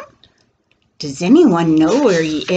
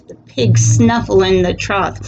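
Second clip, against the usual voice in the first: usual speaking voice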